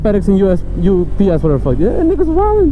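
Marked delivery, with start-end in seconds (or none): 1.8-2.7 high-pitched comic exclamation; mock outrage. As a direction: squeal it in exaggerated disbelief. Mock rant resumed.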